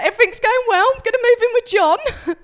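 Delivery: giddy friend. Retold or imitated. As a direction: gush it, overexcited. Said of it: "'Cause she's like".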